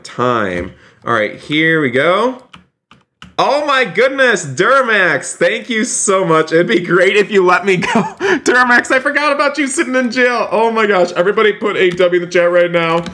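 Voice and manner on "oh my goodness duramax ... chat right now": high-pitched, excited outburst; thrilled shock. Real, not acted. Streamer thanking a donor and remembering he's jailed, thrilled and shocked. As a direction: gush in a high, astonished voice.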